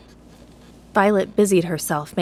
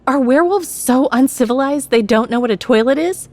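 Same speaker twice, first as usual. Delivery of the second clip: louder, higher scornful jab; insulting; 7 semitones higher, 7 dB louder than usual. A narrator's reading. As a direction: sneer an insult. Violet's insult.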